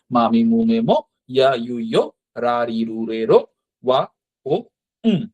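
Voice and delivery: even rote drill, row by row; steady classroom chant of syllables; kana chart recitation. Shown as a demonstration. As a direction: lift the last syllable of each row.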